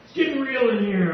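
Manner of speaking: embarrassed laugh through the words. Real, not acted. Preacher recovering from tears, laughing at herself.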